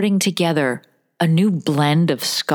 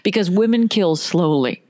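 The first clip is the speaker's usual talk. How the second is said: normal talking voice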